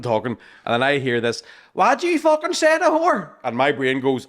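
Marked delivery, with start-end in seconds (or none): 1.7-3.3 put-on high, loud drunken challenge; hammed-up yell at the cousin; aggressive yell. Drunk lad yelling at the cousin, hammed-up aggressive yell. Aggressive young fella in the pub.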